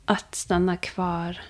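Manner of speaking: ordinary talk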